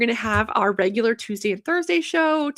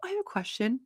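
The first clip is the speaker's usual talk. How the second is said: calm; 3 semitones higher, 8 dB quieter, faster than usual. Retold or imitated. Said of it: "very calmly goes".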